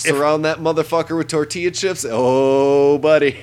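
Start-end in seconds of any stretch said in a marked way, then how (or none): 2.1-3.4 excited. Real, not acted. Excited about nachos.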